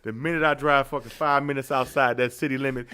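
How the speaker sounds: ordinary talk, nothing marked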